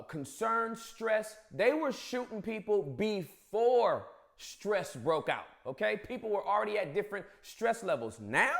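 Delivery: usual speaking voice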